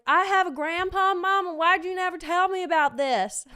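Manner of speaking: put-on loud, high country-boy outburst; indignant teen with a country twang; country accent. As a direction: demand answers in a hammed-up country accent. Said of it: "my country accent".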